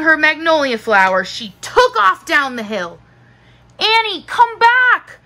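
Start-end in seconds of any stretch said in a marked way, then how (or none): 3.8-5.2 high, loud shout after someone; urgent high-pitched yell. A narrator's reading. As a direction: shout her back, pitched way up. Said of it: "Jack shouted".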